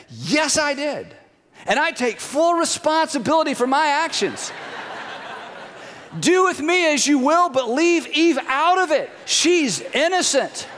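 hammed-up noble Adam taking the blame; mock noble confession. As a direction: play the gallant husband for laughs. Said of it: "That's what he should have said".

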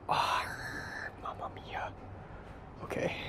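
breathy whispered dismay. Real, not acted. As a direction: whisper it under your breath. Mishap with dishwasher detergent.

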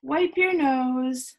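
ordinary delivery, nothing marked

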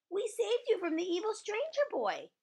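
high, loud proud announcement; proud, high-pitched declaration. Acted. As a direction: announce your heroic deed proudly. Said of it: "very proud".

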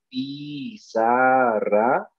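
very slow "pizarra" as PI, ZA, RRA; syllable by syllable. Shown as a demonstration. Teacher tells pupil "fíjate", "pizarra" drawn out by syllables. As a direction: stretch each syllable of "pizarra". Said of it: "mira, fíjate".

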